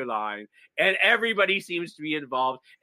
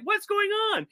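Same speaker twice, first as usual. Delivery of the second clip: high, puzzled "What's going on?"; a hint of baffled bystanders; mock confusion. Everyone on the show puzzled by Doug, mock surprise.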